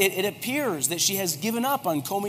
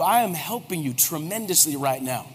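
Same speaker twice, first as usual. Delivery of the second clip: ordinary delivery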